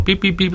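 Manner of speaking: high, soft repeated beeps; little high electronic pips; imitated machine beeps. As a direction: mimic a blinking answering machine's beeps. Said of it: "was beeping away on the answer machine".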